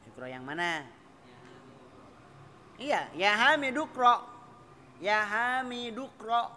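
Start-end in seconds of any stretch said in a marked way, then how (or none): 3.1-6.5 "Hamidu, ukro" run together as Hamiduk-ro; high, loud Hamidukro for "Hamidu, ukro"; linked reading. Shown as a demonstration. Teacher demonstrates the linked reading.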